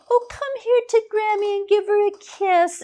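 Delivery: high, sweet coaxing granny voice; sugary grandmother calling a child over; doting grandma. Retold or imitated. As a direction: coo at the toddler like a grandma. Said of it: "one of the women says".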